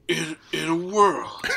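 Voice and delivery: hammed-up trailer narrator. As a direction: announce it like a film trailer, drawn out.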